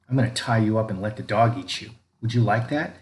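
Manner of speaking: ordinary delivery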